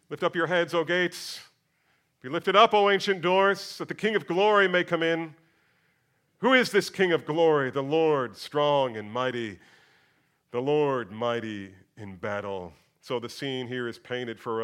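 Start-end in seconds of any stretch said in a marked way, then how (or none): 0.1-5.3 reading the psalm aloud with weight; scripture reading. Acted. 6.4-7.7 reading the psalm aloud with weight; scripture reading. Acted. 7.7-12.7 reading the psalm aloud with weight; scripture reading. Acted.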